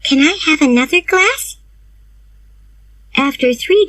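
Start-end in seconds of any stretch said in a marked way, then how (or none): 0.0-1.6 Diana's voice. A narrator's reading. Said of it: "she said".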